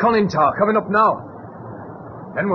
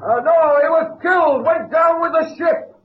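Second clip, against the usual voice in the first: shouting across; 7 semitones higher than usual. Acted. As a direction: call out to be heard on the sub. Shouting reply to submarine.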